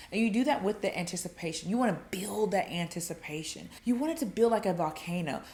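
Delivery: usual speaking voice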